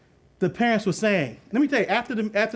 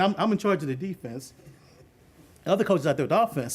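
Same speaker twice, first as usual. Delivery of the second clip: ordinary talk, nothing marked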